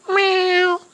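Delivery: high mock cat meow. The kitty answering its owner, high meow.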